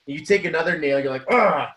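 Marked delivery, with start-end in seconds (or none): 1.3-1.7 a short frustrated "Ah" pushed out louder; mock angry grunt. Builder hammering nails, grunting in mock anger. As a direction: grunt at the nail like you hate the job. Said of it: "you're just angry at this business".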